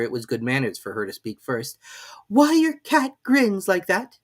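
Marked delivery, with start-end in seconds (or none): 2.3-4.2 put-on high, hesitant girl; timid Alice. A narrator's reading. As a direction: ask shyly. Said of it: "a little timidly".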